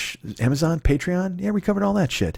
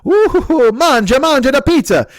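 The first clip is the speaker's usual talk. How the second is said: Italian chef voice; 11 semitones higher, 5 dB louder, slower than usual. Acted. Mock pizza owner, Italian flourish.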